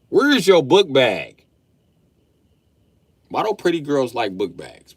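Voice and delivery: loud, slow fatherly demand; loud slow scolding question; scolding dad. Retold or imitated. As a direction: scold her like a fed-up dad. Scolding daughter.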